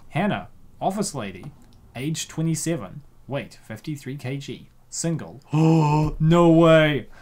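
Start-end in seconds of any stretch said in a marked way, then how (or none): 5.5-7.1 loud, exaggerated gasped disbelief; gasp, then cry out in fake amazement; mock shock.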